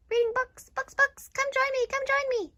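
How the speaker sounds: high, bubbly chant; eager invitation. Acted. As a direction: urge a friend over, brimming with glee. Cheerful invitation to read.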